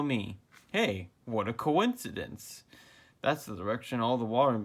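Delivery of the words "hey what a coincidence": normal talking voice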